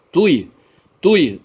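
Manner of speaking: "Tui" said loud and high, the u dominating; stressed syllable. Shown as a demonstration. Teacher showing where the stress falls in gratuito, loud and high. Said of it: "recebendo o acento tônico".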